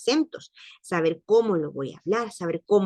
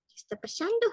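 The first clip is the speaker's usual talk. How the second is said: mock confused squeak; 14 semitones higher, 4 dB quieter, faster than usual. Real, not acted. Teacher confused by own notes.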